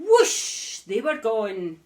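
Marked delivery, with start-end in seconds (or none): 0.0-0.8 whoosh. A narrator's reading. Broomstick takes off.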